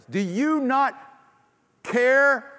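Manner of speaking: high, loud anguished plea; desperate cry. Acted. Believer crying to Jesus in despair, anguished. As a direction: cry out as if about to die. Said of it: "we think we're gonna die".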